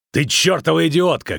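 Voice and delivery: high-pitched, furious yell; angry shout. A narrator's reading. Witcher to the dryad who shot, angry shout. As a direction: shout it in outrage. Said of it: "крикнул ведьмак".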